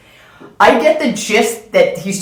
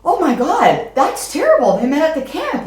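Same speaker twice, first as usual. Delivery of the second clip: high, panicked thoughts; panicked inner voice; 8 semitones higher than usual. Retold or imitated. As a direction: think it in a rising panic. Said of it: "'Cause I'm thinking".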